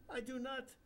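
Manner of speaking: put-on high, sing-song Indian accent; jovial Indian guru. Bapucharya teasing the narrator, Indian accent. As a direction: joke like a cheeky holy man.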